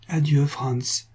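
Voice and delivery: slow, drawn-out sad goodbye; understated sighing adieu; sighing farewell. A narrator's reading. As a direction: let the farewell sink with a sigh. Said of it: "avec un gros soupir".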